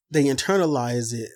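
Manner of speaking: normal talking voice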